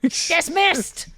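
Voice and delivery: high, curt dismissal; shrill yelling. Acted. Boss sends her enforcer away, shrill dismissal. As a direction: yell it as a shrill command. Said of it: "when she yells at me".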